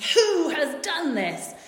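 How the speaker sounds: loud, high, slow indignant demand; cross. Retold or imitated. King hears Esther's plea, quite cross. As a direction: demand it crossly, drawing out each word. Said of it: "The king is quite cross about this".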